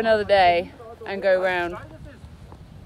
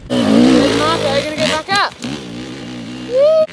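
cheering; 7 dB louder than usual. Real, not acted. Cheering a rider's climb.

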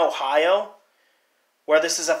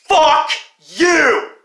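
shouting in real anger, voice high and loud; furious rant; 7 semitones higher, 6 dB louder than usual. Real, not acted. Angry customer shouting at Dish Network, furious. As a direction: let the fury boil over, yelling. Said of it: "I'm sorry for being this mad".

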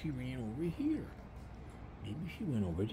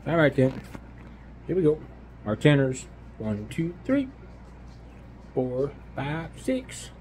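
much louder counting out; 10 dB louder, much slower than usual. Pretend. Counting aloud so the hider hears, much louder. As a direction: count out loud for the game.

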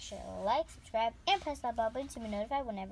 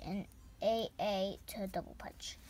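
whispering; 5 semitones lower, 5 dB quieter than usual. Real, not acted. Whispered recap.

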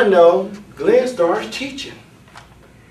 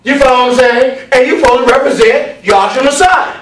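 high, raised preacher's cadence; forceful preaching; 8 semitones higher, 4 dB louder than usual. Real, not acted. Preacher warns the class what to preach, forceful preaching. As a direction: hammer each phrase out to the congregation.

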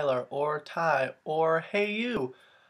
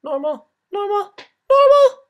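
mock voiceover; 4 semitones higher, 6 dB louder than usual. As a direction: repeat higher and louder until it peaks absurdly. Mock dancing to own voiceover.

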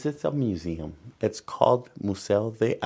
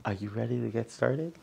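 usual speaking voice

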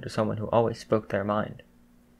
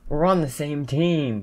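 higher, slow, drawn-out retort; yelled retort; 4 semitones higher, slower than usual. A narrator's reading. Bakugo answers his teammate's protest, mild yell. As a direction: yell back, stretching the words. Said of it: "Bakugo yelled in response".

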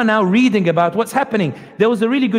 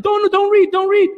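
desperate pleading; 12 semitones higher than usual. Retold or imitated. Israeli voice in the skit begging the newcomer not to read, much higher. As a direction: beg frantically to stop them. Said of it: "And I'll try to imitate it here".

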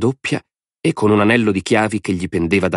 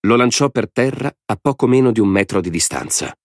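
usual speaking voice

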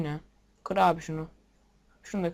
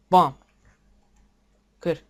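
normal talking voice

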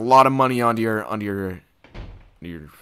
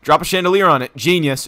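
excited shout; 6 semitones higher, 7 dB louder than usual. Real, not acted. Excited during game fight.

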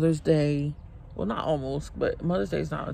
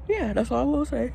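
higher, amused repeat of the closing line; playful repeat; 10 semitones higher, 3 dB louder, faster than usual. Real, not acted. Mom wrapping up her point to viewers, playful and higher. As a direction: toss the line off again, pitched up and teasing.

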